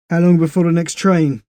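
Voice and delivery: a practical British soldier. Acted. Les asks.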